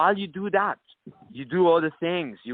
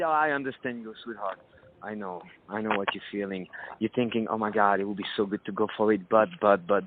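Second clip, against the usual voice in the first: seductive murmur; 7 semitones lower than usual. Shown as a demonstration. Man talks low and seductive to a hesitant woman. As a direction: coax her gently, like a lover close by. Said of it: "talk to her".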